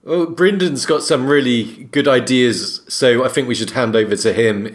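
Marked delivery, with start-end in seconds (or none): none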